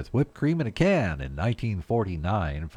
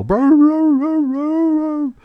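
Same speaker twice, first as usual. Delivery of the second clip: very high, loud mock dog brr-ing; dog brr noises; 12 dB louder, much slower than usual. Host imitating dogs dashing outside, very high and loud brr. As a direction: rev it up like an excited dog at the door. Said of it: "They wanna go out and go".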